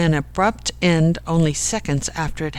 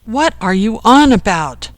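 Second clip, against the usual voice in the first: raised, louder, puzzled demand; yelling; 4 semitones higher, 5 dB louder than usual. A narrator's reading. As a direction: throw the question back loudly. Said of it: "yelled Jack".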